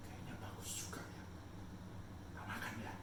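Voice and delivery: whispered, tempted musing; secret temptation. Acted. Eve secretly talking herself into eating, whispered. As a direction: whisper it like a forbidden thought.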